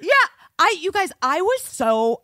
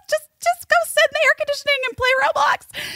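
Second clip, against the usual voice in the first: high exasperated self-quote; faster than usual. Retold or imitated. Mother telling daughter to go play Roblox, high and quick. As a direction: shoo the kid off brightly. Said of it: "And I was like".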